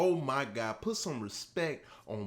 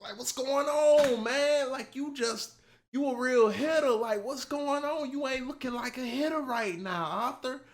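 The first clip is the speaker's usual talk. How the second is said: high-pitched mock scolding of a friend; mock disappointed friend, pitched up; Tommy scolding; 10 semitones higher than usual. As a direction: imitate Tommy telling him to get it together. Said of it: "Tommy wasn't playing".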